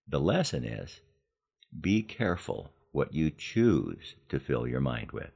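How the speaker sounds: ordinary delivery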